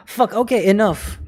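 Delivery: ordinary delivery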